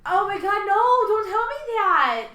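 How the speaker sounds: horrified, beg them to stop; alarmed. Real, not acted. Reacting to hearing about a tick.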